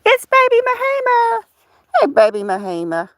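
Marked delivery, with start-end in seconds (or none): 0.1-1.4 voicing the puppy, much higher; squeaky little dog voice; high pet voice. Owner speaking for her dog in a high voice.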